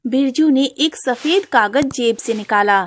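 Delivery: ordinary delivery, nothing marked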